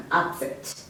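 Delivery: the noun "upset", UP-set; weight on the first syllable of "upset"; stress on first syllable. Shown as a demonstration. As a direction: demonstrate UP-set, as in a stomach upset. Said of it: "emphasis on the first syllable".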